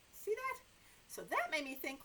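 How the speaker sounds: ordinary delivery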